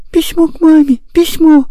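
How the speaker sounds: put-on very high, upset child's cry; tearful girl. A narrator's reading. Girl over her ruined letter to mama, high tearful wail. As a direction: wail it as a little girl, stopping short of sobs. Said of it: "горько заплакала".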